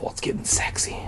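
hushed, breathy aside; whisper. Real, not acted. Reader breaking off the story for viewers, whispered. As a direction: drop to a whisper as if caught.

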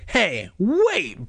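protesting. A narrator's reading. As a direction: squirm and object. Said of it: "Bird protested".

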